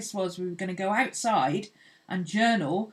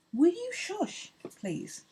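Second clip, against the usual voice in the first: singsong, high-pitched shushing; cooed shushing; 12 semitones higher, slower than usual. Real, not acted. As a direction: plead slowly with a noisy pet. Shushing a noisy dog.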